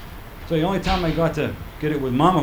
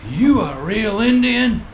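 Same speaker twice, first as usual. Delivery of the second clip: slow, higher, lightly voiced questioner; mock curious asker; 7 semitones higher, much slower than usual. People quizzing him, slow mock question. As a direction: drag out the question like a nosy stranger. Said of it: "They say".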